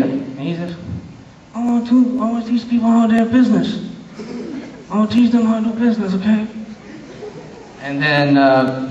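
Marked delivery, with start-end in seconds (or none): 1.5-6.5 fast, mumbled mafia boss; Don Corleone. Acted. Don explaining his plans to the interviewer, quick godfather mumble. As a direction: run the words together like Brando's godfather. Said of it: "You don't even see his lips moving".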